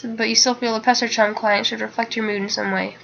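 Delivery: usual speaking voice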